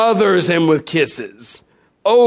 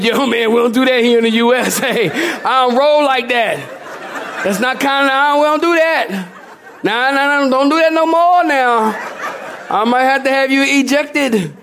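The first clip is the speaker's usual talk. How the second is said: higher, laughing, street-casual brush-off; laughing refusal; 7 semitones higher than usual. Retold or imitated. Preacher telling the kissing visitor to back off, laughing. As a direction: wave him off while cracking up. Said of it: "And I went".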